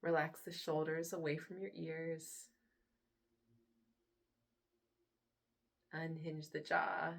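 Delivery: soft, unhurried meditation voice with long pauses; guided meditation. Acted. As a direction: hush down and gently lead them into relaxation. Leads a guided relaxation.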